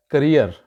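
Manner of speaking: करियर with a short unstressed first vowel, stress on the second syllable; correct pronunciation. Shown as a demonstration. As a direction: say करियर as kuh-REER, weight on the end. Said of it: "actual इसकी जो pronunciation है".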